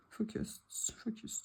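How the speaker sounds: soft unvoiced whisper of the word Focus. Real, not acted. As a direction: whisper "Focus" twice, almost silent. Whispers the label word twice.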